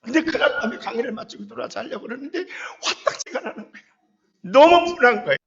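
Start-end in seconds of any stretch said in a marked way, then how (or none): 4.4-5.4 much louder burst of remembered fury; loud, heated cry of resentment; indignant outburst. Real, not acted. Recalling rage.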